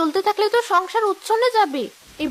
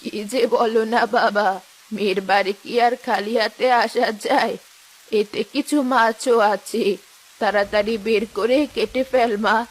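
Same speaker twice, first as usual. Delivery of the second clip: put-on old mother; 10 semitones lower than usual. Old mother tells son-in-law not to fuss, low old voice. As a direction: speak like a fond elderly mother.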